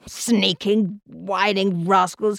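spiteful aged voice, dwelling on the insults; venomous old-man indignation; malevolent old man. A narrator's reading. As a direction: rant like a bitter old invalid. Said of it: "screeched Mister Elm malevolently".

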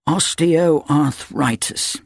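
slow, muttered doctor's word; muttered diagnosis. A narrator's reading. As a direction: murmur it while writing. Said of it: "he muttered".

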